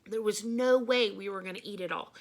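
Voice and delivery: usual speaking voice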